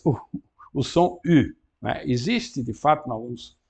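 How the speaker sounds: ordinary delivery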